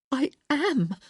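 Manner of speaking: high, firm insistence; insisted. A narrator's reading. Woman insisting to her lover, insistent. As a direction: insist it, a bit breathless. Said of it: "she insisted".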